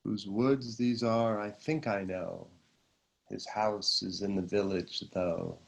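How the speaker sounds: soft, low poetry reading; hushed, calming recital with pauses between lines; meditative poem recitation. Acted. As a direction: let the verse settle quietly into the breath. Recites verse during breathing meditation.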